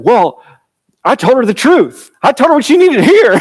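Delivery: high, indignant self-justifying husband; defensive Jacob. Retold or imitated. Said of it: "He's like".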